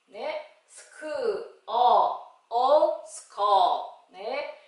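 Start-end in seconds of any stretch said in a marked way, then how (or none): 0.7-4.1 "스크, 어, 어" as loud separate sounds, then "스커" stretched; phonics blending. Shown as a demonstration. Teacher reading SK words, slow and strongly segmented. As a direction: punch each vowel, then blend "스커". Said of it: "에스케이 글자로".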